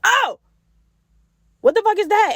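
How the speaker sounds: high-pitched yelp of alarm, re-enacted; startled, scared. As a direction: jump in fright, then a high shocked question. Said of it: "it scared me for a second".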